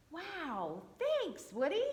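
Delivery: high, delighted thanks; high storybook exclaim; excited exclaim. Acted. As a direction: gush with surprise at the gift. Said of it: "Splint exclaimed".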